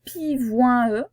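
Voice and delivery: "pivoine e" with nasal "ouin" plus an e; wrong pronunciation. Shown as a demonstration. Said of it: "on ne dit pas".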